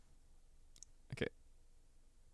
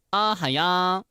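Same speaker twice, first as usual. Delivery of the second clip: higher, louder squeaky greeting; cutesy anime voice; 8 semitones higher, 6 dB louder than usual. Shown as a demonstration. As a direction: chirp it like a cute anime girl. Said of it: "one line in multiple different voices".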